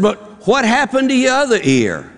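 very high-pitched, incredulous question; mock puzzlement. Boss to worker with bandaged ears, mock-puzzled. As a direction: ask it in hammed-up disbelief, pitched high.

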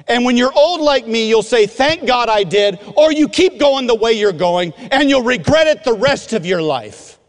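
high, emphatic retold warning; urgent exhortation. Older mentor to crying student, urgent exhortation.

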